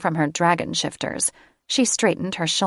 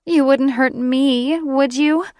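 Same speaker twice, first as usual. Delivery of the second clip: much higher, sugary coo; 8 semitones higher, 5 dB louder than usual. Acted. Catherine stroking Drake's cheek, higher cooing. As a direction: sweet-talk him. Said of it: "Catherine cooed".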